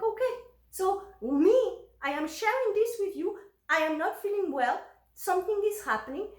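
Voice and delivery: high-pitched, emphatic spelling out; retold insistent plea. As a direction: imitate yourself pleading to be heard.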